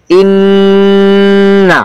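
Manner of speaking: ইন্না with a long, steady hum on ন; held ghunnah. Shown as a demonstration. Teacher demonstrating ghunnah to students, ইন্না with prolonged ন. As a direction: hold the ন of ইন্না very long, then release. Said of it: "কিছুক্ষণ সময় দূরে রাখতে হবে".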